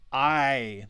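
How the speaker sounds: I said as a full long eye; long I sound. Shown as a demonstration. Teacher showing the long I to students. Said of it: "the long I sound".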